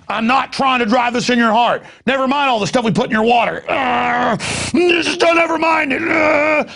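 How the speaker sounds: a squealing, shouted mock of the New World Order; mocking villain voice. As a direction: ham up the sneering enemy pretending innocence.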